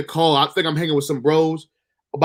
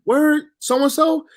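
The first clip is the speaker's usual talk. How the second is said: shocked question; 11 semitones higher, much slower than usual. Retold or imitated.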